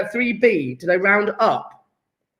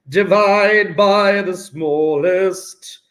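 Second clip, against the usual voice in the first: very slow, stretched-out words; drawn-out reminder; much slower than usual. Pretend. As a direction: draw out every word of the rule. Chanting the step to remember.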